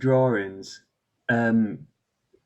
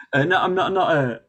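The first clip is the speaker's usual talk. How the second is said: normal talking voice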